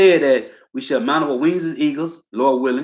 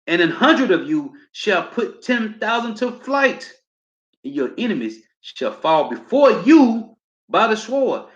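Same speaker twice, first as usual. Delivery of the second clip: slow, climbing pulpit reading, pitch higher; rising slow scripture declamation; preaching scripture; 4 semitones higher, slower than usual. Acted. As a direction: build it slowly, higher on each line. Preacher reads scripture aloud.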